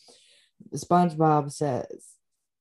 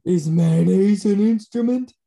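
loud, dopey cartoon starfish voice; Patrick voice; 4 semitones higher, 9 dB louder than usual. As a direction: belt it like a clueless cartoon starfish. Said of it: "How about a Squidward impression?".

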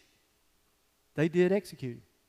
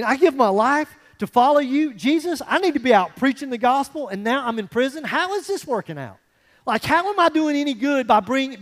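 raised, louder pleading complaint to God; Paul complaining; 5 semitones higher, 6 dB louder than usual. Retold or imitated. Paul in prison questioning God, louder indignant complaint.

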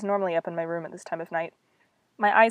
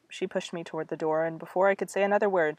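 ordinary delivery, nothing marked

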